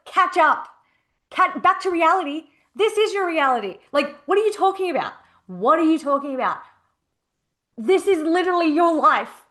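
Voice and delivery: retold yelling. Coach retelling how she yelled at her client, exasperated. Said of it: "which made me yell at her".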